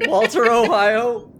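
slow, laughing Walter Ohio; drawn out through laughter. Real, not acted.

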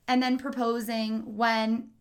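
ordinary talk, nothing marked

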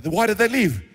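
acted royal indignation; indignant demand. Pharaoh demanding to know why they left, indignant.

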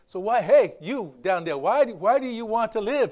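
mock calling down to someone; playful movie re-enactment, voice lifted; movie character calling out. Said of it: "he pushes, he goes".

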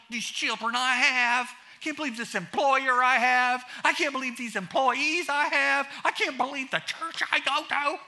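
high, loud, sing-song complaining list; whiny complainer. Retold or imitated. Pastor mimicking a grumbler's endless complaints, whining. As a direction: whine through every grievance. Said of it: "He said".

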